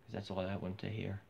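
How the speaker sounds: ordinary talk, nothing marked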